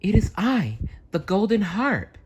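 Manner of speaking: high whispered little voice; tiny voice. Acted. Golden Harp answering the farmers from the box. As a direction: speak small and airy. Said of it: "said the tiny voice".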